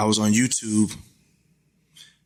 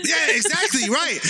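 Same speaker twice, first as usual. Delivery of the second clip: laughing; 14 semitones higher than usual. Real, not acted. Laughs at Trebek joke.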